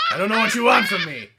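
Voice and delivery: stock cop voice, half laughing; generic officer voice. Pretend. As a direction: play a bland generic officer.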